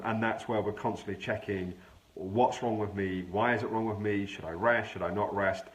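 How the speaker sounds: normal talking voice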